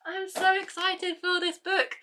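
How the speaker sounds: high, slow, sing-song squeal; excited squeal. Real, not acted. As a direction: stretch it out way up high like a fangirl. Holding the new book.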